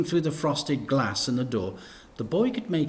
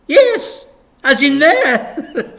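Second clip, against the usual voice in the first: high, loud, over-eager vicar voice; high loud cheery vicar; gushing vicar; 13 dB louder, much slower than usual. Acted. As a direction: beam it out like a toothy vicar.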